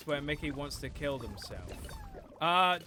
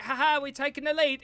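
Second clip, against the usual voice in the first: mock gloat; 9 semitones higher, 6 dB louder than usual. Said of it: "or like".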